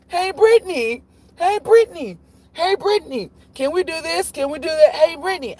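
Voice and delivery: put-on very high, insistent kid voice, over and over; pestering kid. Stepkid nagging during Spy Kids, imitated in high pestering voice. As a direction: imitate a child nagging for attention without letup. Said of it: "somebody keeps saying".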